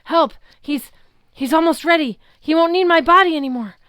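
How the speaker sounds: high, loud, rushing panic; panicked plea. A narrator's reading. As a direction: scream for help as you lose yourself. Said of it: "Luke's voice".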